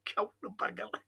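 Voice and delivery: whispered, mocking repeat. Real, not acted. Host whispering the jibe to listeners, unvoiced mocking whisper. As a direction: breathe it out under your breath.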